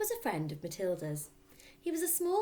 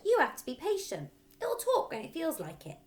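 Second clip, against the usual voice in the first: put-on little boy explaining; 11 semitones higher, 6 dB louder than usual. A narrator's reading. Fred explains to Matilda.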